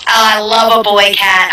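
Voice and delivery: ordinary talk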